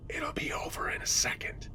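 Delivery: very soft, fully whispered threat; hushed, creepy whisper; menacing whisper. Acted. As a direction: whisper it as if shushing someone. Intruder shushes her, finger at lips.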